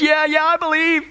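high, mock-frantic assent; acted desperate agreement. Dangling tourist assures the voice above, acted desperation. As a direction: agree eagerly in a high, hammed-up panic.

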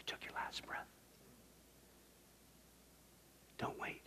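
barely audible whisper; grave, breath-soft pulpit whisper; hushed warning. Acted. Solemn warning about dying.

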